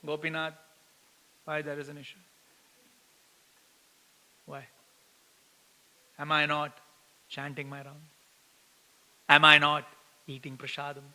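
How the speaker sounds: hushed, plaintive lament to God; a soft mock whimper of complaint; quiet self-pitying plea; whining prayer. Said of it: "our typical reaction is".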